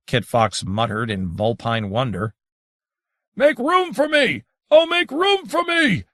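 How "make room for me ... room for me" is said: much higher, booming cry, rising further on the second. A narrator's reading. Adrian of the Mountain before vanishing, booming cry. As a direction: boom it out like a mountain. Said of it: "Adrian Mountain boomed".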